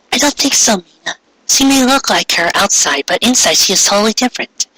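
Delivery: put-on high, polite girl voice; a shy schoolgirl introducing herself; Ami's voice. A narrator's reading. Ami greeting the new girl, high and polite.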